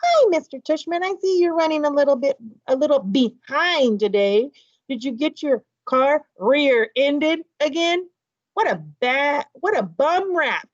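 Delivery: put-on playful old lady act for his son. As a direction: keep the mock old lady going, chatty and fussy. Dad keeps the old lady voice.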